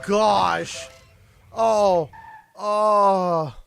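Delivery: ordinary talk, nothing marked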